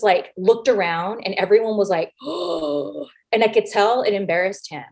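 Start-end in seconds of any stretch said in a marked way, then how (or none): 2.2-3.0 high, softer crowd gasp; imitated shocked gasp. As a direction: gasp like stunned onlookers. Onlookers react to her retort.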